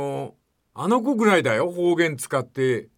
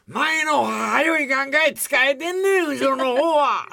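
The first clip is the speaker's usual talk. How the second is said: imitating an Osaka tourist, high and slow; mock Osaka accent called out from behind; Osaka dialect; 9 semitones higher, 3 dB louder, slower than usual. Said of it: "後ろの方から聞こえてきた大阪弁で". (大阪弁のまね、高くゆっくり)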